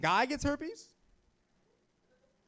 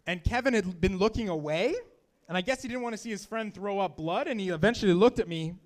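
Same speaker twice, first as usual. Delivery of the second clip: usual speaking voice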